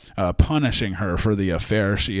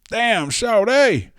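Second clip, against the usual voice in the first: high, loud "Damn, Sade!" like an impressed cartoon wolf; mock horny exclamation; 11 semitones higher, 4 dB louder than usual. Zeus spotting Io, a high loud catcall. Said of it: "He's like".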